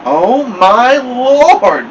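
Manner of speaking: high, loud, long-drawn wail; slow, swooping horrified scream; screamed shock. A narrator's reading. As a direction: cry out in melodramatic horror. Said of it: "Mama screamed".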